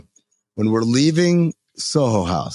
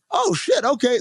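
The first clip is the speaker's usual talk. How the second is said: very high-pitched impressed reaction; retold excitement; 11 semitones higher than usual. Storyteller hears of a karaoke room, excited. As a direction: replay being pleasantly taken aback.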